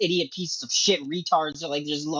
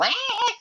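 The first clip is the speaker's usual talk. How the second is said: mock battle cries. Mocking Hawkgirl murdering.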